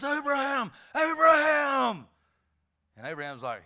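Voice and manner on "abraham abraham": higher, louder and slowing on the second "Abraham!"; emphatic call. Acted. Jesus calling Abraham with great emphasis, a slow shout. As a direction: call with force to rock the heavens. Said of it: "with as much emphasis that I would imagine rocked the heavens".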